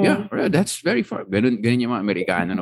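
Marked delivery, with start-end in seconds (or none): 0.0-1.2 put-on high, friendly American accent; a chatty American tourist in an American accent; imitating an American accent in the elevator. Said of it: "same American speaker continues".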